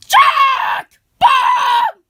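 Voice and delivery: loud, high-pitched yell of names; screamed call. Acted. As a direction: scream out for someone to come over. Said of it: "you had to scream like that".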